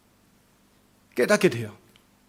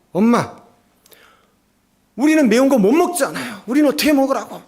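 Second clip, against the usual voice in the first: put-on high, loud, whining kid's complaint; whiny complaint; 7 semitones higher, 5 dB louder than usual. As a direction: sulk and protest like a child who can't eat spicy food. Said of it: "짜증을 내면서 막 불평을 하는 거예요". (높고 크게 짜증 내며 투정하는 아이 목소리)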